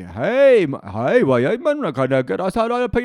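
high, loud Geordie accent; authentic Geordie accent. Shown as a demonstration. Coach showing off to interviewer, shouted Geordie accent. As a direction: holler it in broad, hammed-up Geordie. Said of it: "sound like an authentic Geordie".

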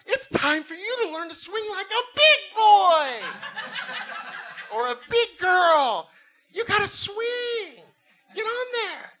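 high, loud patronizing parent voice; slow, coaxing singsong to a little kid; sing-song parent. Retold or imitated. Parent telling a kid to swing alone, high patronizing coax. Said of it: "They probably said it like this".

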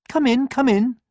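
high cheerful hen; a bright welcome; welcoming hen. A narrator's reading. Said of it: "said the hen with a wave".

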